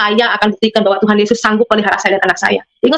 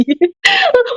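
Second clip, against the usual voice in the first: laughing. Real, not acted. Mother laughs about her son who now loves pork. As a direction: burst of high laughter.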